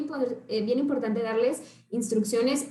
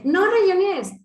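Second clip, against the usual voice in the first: mock scold; 7 semitones higher, 7 dB louder than usual. Parent scolding scribbling child.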